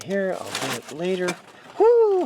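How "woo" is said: sharp high whoop of surprise; excited whoop. Real, not acted. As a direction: yelp up high. Grabbing the hot ribs.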